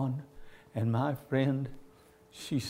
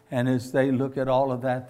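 ordinary talk, nothing marked

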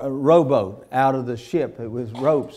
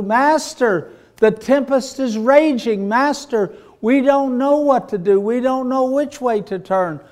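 higher, louder panicked pleading; desperate cry; 9 semitones higher, 4 dB louder than usual. Acted. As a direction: beg the master over the storm. Disciples crying to Jesus in storm.

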